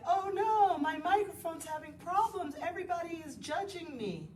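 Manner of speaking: high, loud wail of dismay; mock panic. Yoga teacher acting out worry over microphone problems, mock panic. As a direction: wail it in hammed-up self-conscious distress. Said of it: "I could be all like".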